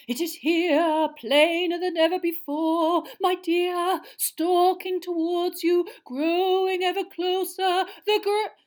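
high, loud, slow doom-laden seer; ominous prophecy. A narrator's reading. Seer foretelling doom to Harry, loud and slow. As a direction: build a dire warning.

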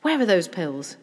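loud, eager question; hearty burst of energy; excited cry. A narrator's reading. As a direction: call it out, raring to start. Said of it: "he cried, bouncing up".

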